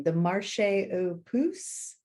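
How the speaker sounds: slow whispered French, Marché aux Puces said gingerly; careful French attempt. Shown as a demonstration. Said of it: "I'll try to say the name".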